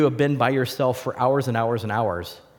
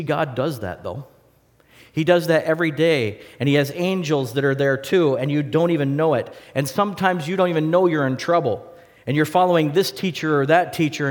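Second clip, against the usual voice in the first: ordinary talk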